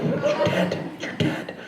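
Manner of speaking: hushed stage whisper; soft, voiceless aside; urgent whisper. Acted. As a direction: whisper it as a secret prompt. Urging hippie to play dead.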